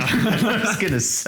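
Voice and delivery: laughing high and slow; chuckling through the words. Real, not acted. Laughing reply.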